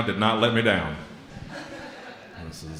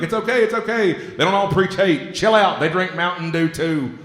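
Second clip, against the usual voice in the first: much higher, louder pleading voice, slowing down; calm both sides down with mock urgency; soothing ambassador; 8 semitones higher, 3 dB louder than usual. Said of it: "Be like".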